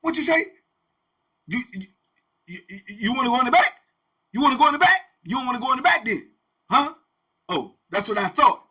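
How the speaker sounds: loud, high taunting warden. Retold or imitated. Warden daring an inmate to speak up, loud taunt. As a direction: dare him to talk back. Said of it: "he'll say something like that to you".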